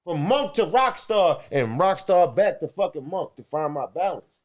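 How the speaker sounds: usual speaking voice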